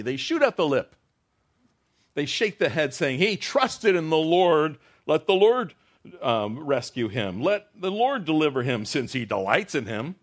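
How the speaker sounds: loud, high, slow proclaiming of the mockers; preaching at full force. Preacher voicing the mocking crowd at the cross, loud. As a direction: proclaim it big to the room.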